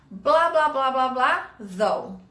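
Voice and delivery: falling though at the end. Shown as a demonstration. Teacher showing where though goes, falling at the end. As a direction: drop your voice on the final though. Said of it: "Lá no final".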